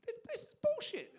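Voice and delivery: high, squeaky mock protest; mock whine. Dad mock-whining at his father.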